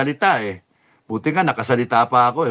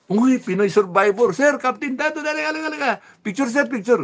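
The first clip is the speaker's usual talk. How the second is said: much higher, eager fan calls; excited fan calling; 11 semitones higher than usual. Retold or imitated. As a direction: wave him over like a starstruck fan. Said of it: "fans calling him over".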